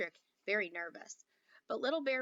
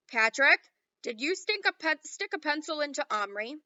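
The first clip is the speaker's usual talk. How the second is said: loud, higher accusation; accusing headmaster; 5 semitones higher, 9 dB louder than usual. A narrator's reading. Headmaster accusing Patrick of the pencil, loud. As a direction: call out the suspect.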